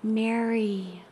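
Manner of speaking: hushed, intimate recognition; gentle Jesus. Acted. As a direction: call her name in a tender hush. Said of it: "and he says to her".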